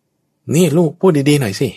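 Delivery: harsh scolding mother. Retold or imitated. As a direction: snap at the child to speak nicely. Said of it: "ด้วยน้ำเสียงแบบเนี้ย".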